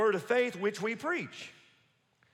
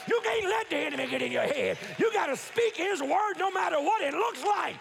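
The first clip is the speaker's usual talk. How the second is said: full-force preaching; 4 semitones higher than usual. Acted. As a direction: drive the story home from the pulpit. Preaching climax.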